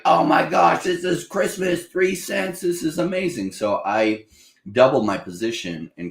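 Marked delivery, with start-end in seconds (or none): 0.0-3.5 much higher, slowed, delighted mock gasp; thrilled buyer. Speaker retelling his joy at the price crash, high delighted gush. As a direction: gush like it's Christmas morning. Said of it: "I was like".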